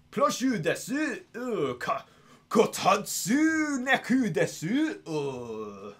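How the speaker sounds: high, very slow, stretched Japanese phrases; overacted Japanese accent with drawn-out grunts; Japanese impression. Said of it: "same with Japanese".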